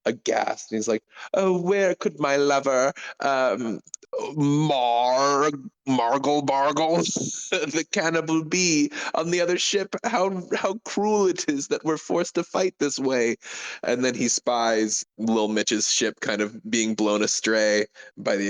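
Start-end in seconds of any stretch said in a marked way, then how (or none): 1.3-13.3 high, melodramatic swooning lover; theatrical lament, pitched high; lamenting lovelorn pirate. Acted. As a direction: bemoan your fate like a tragic romantic. Player voices lamenting lovelorn pirate.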